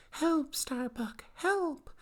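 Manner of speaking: hammed-up damsel crying out for rescue; damsel's scream. Tied-up girl calls Starbuck, hammed-up screaming damsel. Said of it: "she's screaming, going".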